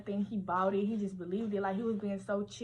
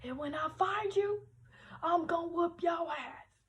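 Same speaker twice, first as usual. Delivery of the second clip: mock threatening yell; 9 semitones higher than usual. Boyfriend searching the locker room, a high mock yelled threat. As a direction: imitate an angry boyfriend yelling a threat. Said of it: "he's screaming in there".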